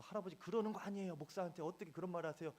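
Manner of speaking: high, fast indignant retort; indignant protest. Retold or imitated. Pastor's unsaid retort to the cursing grandfather, high fast indignant. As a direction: rush out the protest you held back. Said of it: "이런 말을 하고 싶은데".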